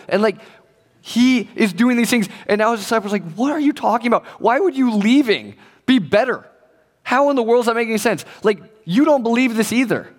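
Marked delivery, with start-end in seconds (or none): 3.3-10.1 higher, animated disbelief; baffled protest. Acted. Disciples questioning Jesus about leaving, baffled protest. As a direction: challenge it incredulously, pitch raised.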